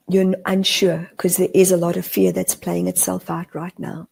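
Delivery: ordinary talk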